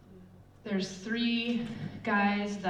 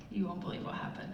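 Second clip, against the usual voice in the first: hushed, fast whisper; secretive whisper behind a shut door; 6 dB quieter, faster than usual. Retold or imitated. Secret told behind closed door.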